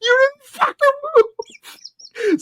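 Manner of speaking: high, loud laughing yell; retold shout. Arguing with the knocked-down shopper, loud laughing shout. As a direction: yell it back at her through laughter.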